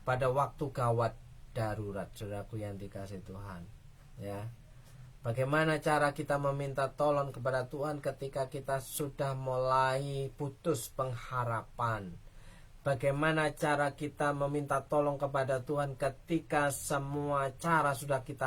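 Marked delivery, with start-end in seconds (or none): none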